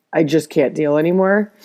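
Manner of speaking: ordinary talk, nothing marked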